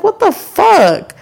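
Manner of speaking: high, drawn-out disbelief; re-enacted baffled outrage; retold exasperation. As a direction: stretch it out, incredulous at the driver. Retold reaction to the driver.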